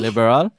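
"liberal" said loudly with misplaced pitch accent; wrong pitch accent. Shown as a demonstration. Teacher testing co-host with a mispronounced word, wrong pitch accent. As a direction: ask loudly, putting the wrong melody on "liberal". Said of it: "a completely different pitch accent".

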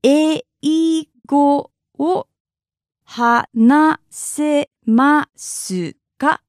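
"Eigo wo hanasemasu ka" very slowly, syllable by syllable; broken down slowly. Shown as a demonstration. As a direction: split "Eigo wo hanasemasu ka" into separate syllables with pauses. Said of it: "let's break it down".

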